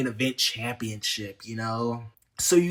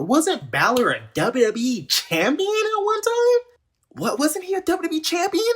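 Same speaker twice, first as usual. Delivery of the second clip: much higher, disbelieving question; high incredulous questioning; 14 semitones higher than usual. Real, not acted. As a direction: ask it in shocked disbelief. Incredulous about Balor's treatment.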